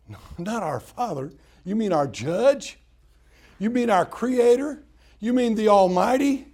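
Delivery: loud mock astonishment; incredulous questions. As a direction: ask as if scandalized. Incredulous listeners questioning Jesus.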